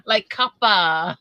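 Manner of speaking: New England "coppah", R dropped, drawn out; New England accent. Shown as a demonstration. Explaining the name to a Southern friend, New England accent. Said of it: "Throw in our little New England accent".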